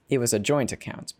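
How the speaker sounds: plain reading voice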